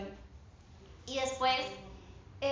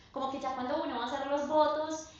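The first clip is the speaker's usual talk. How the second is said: normal talking voice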